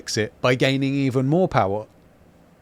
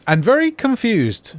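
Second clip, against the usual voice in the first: much higher and slower, teasing; amused mock bewilderment; 10 semitones higher, 4 dB louder than usual. Real, not acted. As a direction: stretch it out, playfully baffled. Mocking the naked mayor.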